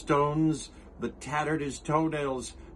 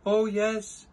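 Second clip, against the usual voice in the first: high, louder chirpy reply; piped; 8 semitones higher, 4 dB louder than usual. Acted. Who mayor answering Horton, high piping reply. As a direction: pipe it up brightly. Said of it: "piped the voice".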